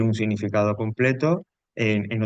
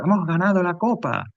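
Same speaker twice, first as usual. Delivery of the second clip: much higher, cheering delivery of a win; euphoric exclamation; 8 semitones higher than usual. Shown as a demonstration. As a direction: sound like you just won the cup. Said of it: "Si yo digo".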